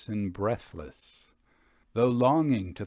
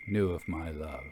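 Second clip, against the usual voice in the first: ordinary delivery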